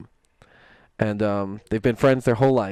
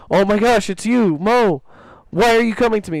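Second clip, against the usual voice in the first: higher, louder amazed cry; 9 semitones higher, 5 dB louder than usual. Retold or imitated. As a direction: sound stunned to meet him. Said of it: "he's like".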